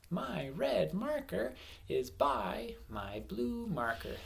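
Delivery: teacher rereads the sentence to count its words; slow word-counting read. Shown as a demonstration. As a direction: read slowly, marking each word to count. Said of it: "how many words are in my sentence".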